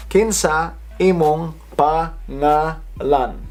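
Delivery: "Kinsa imong pangalan" drawn out slowly, piece by piece; slow breakdown. Shown as a demonstration. Teacher breaking it down for viewers to copy, slow and separated "Kinsa imong pangalan". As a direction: break "pangalan" into pa, nga, lan. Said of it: "So let's break that down".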